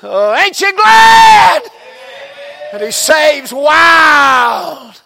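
slow, high, loud preacher's holler; preaching at full force. Acted. Preacher crying out to the congregation, slow and loud. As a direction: stretch it out and shout it to the pews.

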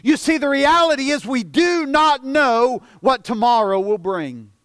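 usual speaking voice

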